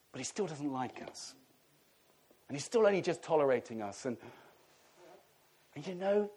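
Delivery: ordinary delivery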